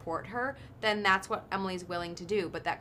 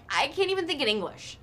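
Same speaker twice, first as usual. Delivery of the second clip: exasperated; 8 semitones higher, 4 dB louder than usual. Retold or imitated. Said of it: "that I'm like".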